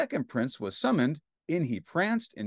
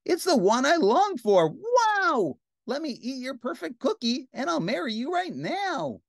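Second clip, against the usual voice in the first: high-pitched excited gushing; gush, starting loud with a big wow; thrilled princess; 11 semitones higher, 4 dB louder than usual. Acted. Princess thrilled by perfect cookie.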